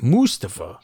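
Mustafa as MOOS-tuh-fuh; first-syllable stress. Shown as a demonstration. Echoing the announcers' version, Mustafa stressed up front. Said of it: "I can't remember it".

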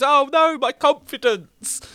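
very high, drawn-out comic wail; hammed-up distress; mock dismay. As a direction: wail like a defeated cartoon villain. Acting out crushed game boss.

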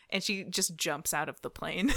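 ordinary talk

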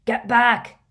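loud, high command; shout. A narrator's reading. Hagrid to the group round Katie, shouting. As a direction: shout to clear everyone away. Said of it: "shouted Hagrid".

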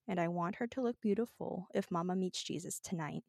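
hushed, heartfelt recital of lyrics; dramatic reading. Pretend. Reading sad lyrics aloud to her friend, hushed and dramatic. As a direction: read it like a tearjerker poem. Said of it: "I'm gonna do a dramatic reading of these lyrics".

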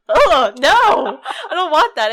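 loud, high, through laughter; mock horror, cracking up; laughing protest. Listener recoils from the raven's voice, laughing.

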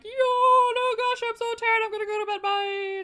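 very high, loud sing-song excuse; fake tired exit. Bea faking sleepiness to leave, high sing-song excuse. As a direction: chirp a fake yawn and flee. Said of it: "she's like".

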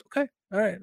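quiet, sardonic okay all right; sarcastic okay. Retold or imitated. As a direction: say it drily, unimpressed. Sarcastic reaction to the film.

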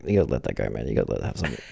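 ordinary talk, nothing marked

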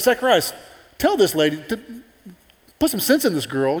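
exasperated appeal. Acted. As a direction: demand he talk sense into her. Relatives appeal to Zacharias.

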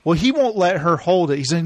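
normal talking voice

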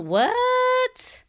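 high disbelieving what; incredulous what. Real, not acted. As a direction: stretch it out, stunned. Incredulous at vaccine hypocrisy.

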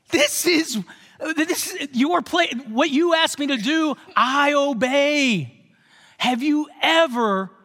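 high, indignant protest at full voice; the wronged loyal son complaining to his father; angry older son. Retold or imitated. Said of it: "the older son was so angry".

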